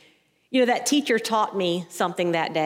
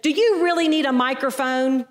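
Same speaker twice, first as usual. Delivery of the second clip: mild mock yell, pitched up; retold yell; 5 semitones higher, 4 dB louder than usual. Student challenging the teacher's microphone, mild mock yell. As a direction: heckle the teacher with a raised voice. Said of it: "This student beside me yells out".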